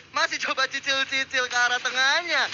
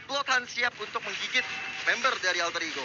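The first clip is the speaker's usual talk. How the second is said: normal talking voice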